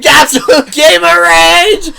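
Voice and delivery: high, loud, slow announcement; raging gamer. Pretend. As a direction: proclaim it like a title. Mocking friend's outburst.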